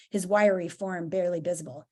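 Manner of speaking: ordinary delivery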